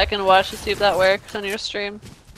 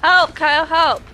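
higher, louder call for help; 6 semitones higher, 4 dB louder than usual. Real, not acted. Shot down in the game, calling teammate Kyle for help. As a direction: cry out to Kyle for rescue.

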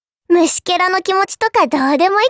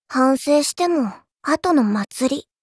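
low, slow and softer; slow low menacing taunt; cold menace; 6 semitones lower, 4 dB quieter, slower than usual. Acted. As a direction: drop low and let each word sink. Skill line, menacing.

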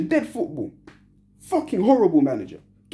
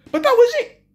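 much higher and louder; exasperated outburst; 5 dB louder than usual. Real, not acted. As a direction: burst out in disbelief. Fan ranting after being knocked out.

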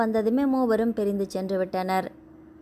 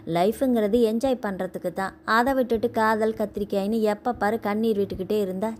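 ordinary delivery, nothing marked

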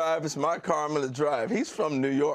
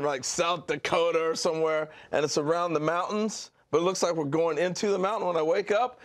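ordinary talk

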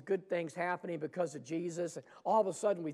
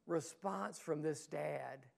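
normal talking voice

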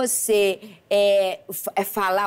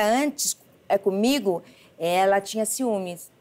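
ordinary talk, nothing marked

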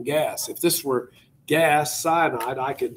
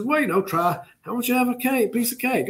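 sly host; 10 semitones higher than usual. Retold or imitated. Yusupov offering the poisoned cake.

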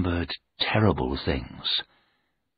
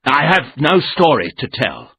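loud, higher shaken refusal; rattled, raised voice; shouting; 6 semitones higher, 7 dB louder than usual. A narrator's reading. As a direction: push them away with a loud no. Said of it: "I shouted".

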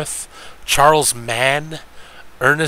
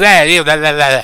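higher, loud yappy blah blah; mock yappy voice; 3 semitones higher, 8 dB louder than usual. Streamer mocking Jimmy the Greek's yappy voice. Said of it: "He had a yappy voice".

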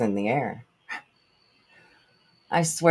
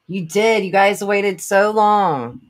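excited; 8 semitones higher, 7 dB louder, slower than usual. Real, not acted. Thrilled at fans waiting.

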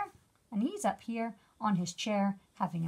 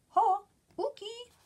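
high, loud bug call; 12 semitones higher, 6 dB louder than usual. Acted. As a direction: call out to a friend like a tiny bug. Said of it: "He's calling to Uki".

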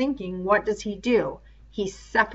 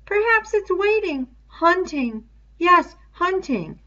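put-on high, eerie little boy; high child voice; 11 semitones higher, 3 dB louder than usual. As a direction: whisper a child's fear aloud. Simon voiced.

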